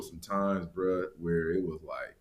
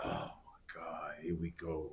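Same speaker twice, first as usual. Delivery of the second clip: breathy whisper of dread; 4 semitones lower, 15 dB quieter than usual. Retold or imitated. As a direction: whisper it, bracing for trouble. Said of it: "and I was like".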